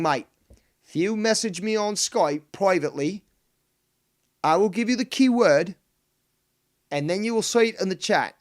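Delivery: a horrid put-on Aussie-Cockney mix; mock Aussie accent. Teasing a viewer in a bad Aussie accent. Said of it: "combination of accents there".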